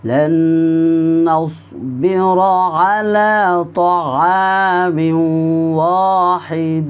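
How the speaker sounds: وإذ قلتم يا موسى drawn out slowly; slow recitation. Shown as a demonstration. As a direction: recite each word long and clear. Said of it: "আমার সাথে সাথে আপনিও উচ্চারণ করে পড়বেন".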